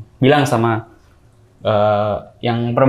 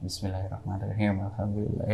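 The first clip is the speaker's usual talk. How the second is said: very soft, low recitation; hushed prayer; 3 semitones lower, 13 dB quieter than usual. Shown as a demonstration. Showing how he prayed at a patient's ear, hushed. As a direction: recite it quietly, close by the ear. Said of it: "aku cuman gini doang".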